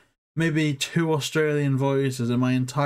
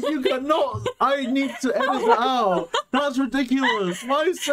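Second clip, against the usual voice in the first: high, flustered, laughing objection; laughing protest; 9 semitones higher than usual. Real, not acted. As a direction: protest through embarrassed laughter. Teased, wants the remark edited out.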